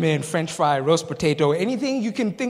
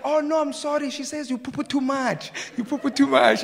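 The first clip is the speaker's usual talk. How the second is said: high-pitched broken-English apology; imitated apologetic neighbor; 9 semitones higher, 3 dB louder than usual. Neighbor correcting her translation, imitated high apology. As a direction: mimic a flustered translator correcting herself. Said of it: "and she said".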